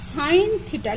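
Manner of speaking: সাইন থিটা said slowly and clearly as "sine theta"; teacher modelling সাইন থিটা; pronounce sine theta. Shown as a demonstration. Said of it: "দেখো এটা উচ্চারণ করবে".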